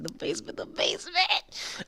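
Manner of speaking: hushed, voiceless squeal of hype; hyped. Retold or imitated. Fan recalls seeing the basement announced, hyped. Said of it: "I was so hyped".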